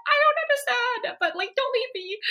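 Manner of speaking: mock-frightened plea not to be left; scared. Scared wife begging her husband not to leave, mock fear. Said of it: "I'm like".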